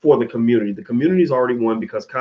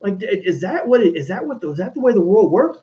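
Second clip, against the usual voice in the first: much higher, pleading in disbelief; incredulous plea; 9 semitones higher than usual. Retold or imitated. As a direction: ask as if betrayed and baffled. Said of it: "to where I'm like".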